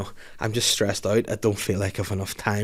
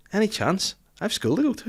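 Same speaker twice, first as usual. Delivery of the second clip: higher-pitched imitation of his bossy five-year-old; playful high child voice; little daughter voice; 10 semitones higher than usual.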